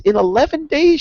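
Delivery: "In eleven days" squealed very high, rising; very excited child. Retold or imitated. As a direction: mimic the niece's thrilled squeal on "days". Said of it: "with all this enthusiasm and passion".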